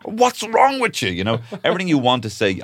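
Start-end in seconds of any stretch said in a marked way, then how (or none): 0.0-1.1 mock exasperated mum. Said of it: "my mom would be like".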